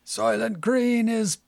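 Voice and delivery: high, drawn-out dramatic yell; hammed-up film-hero shout. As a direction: cry it out slowly as the big movie revelation. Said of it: "And he's yelling".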